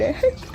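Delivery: quick high giggle; brief laugh at yourself; real short high-pitched chuckle; amused laugh. Real, not acted. Laughs at her absent-minded mistake.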